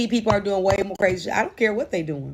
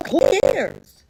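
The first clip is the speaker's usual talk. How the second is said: dismissive; 10 semitones higher, 4 dB louder than usual. Real, not acted. Dismissive outburst.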